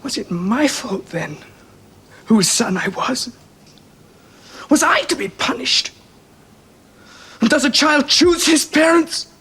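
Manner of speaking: rising into tears, slow; tearful. Acted. Son to his father, slow and tearful. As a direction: let the hurt climb into crying. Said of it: "Now, don't cry".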